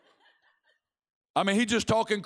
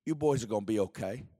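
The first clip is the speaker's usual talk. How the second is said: very low, soft reassurance; a remembered seer's voice, hushed in the ear; Bob Jones; 11 semitones lower, 5 dB quieter than usual. Acted. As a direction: murmur comfort deep and quiet. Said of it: "I've heard Bob Jones's voice in my ear saying".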